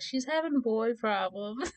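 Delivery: high, drawn-out mock singsong; teasing sister. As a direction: tease slowly, imitating a sister. Sister teases, retold.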